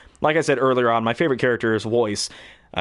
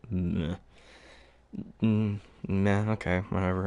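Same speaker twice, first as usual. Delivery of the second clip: bored; 4 semitones lower, 8 dB quieter than usual. Retold or imitated. Bored reaction to game.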